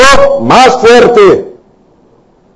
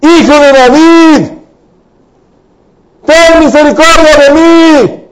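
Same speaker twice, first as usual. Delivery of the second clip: high-pitched, drawn-out plea; crying out louder; 9 semitones higher than usual. Acted. Blind man calls to Jesus for mercy, crying out. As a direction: call out higher, stretching the cry. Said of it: "más fuerte".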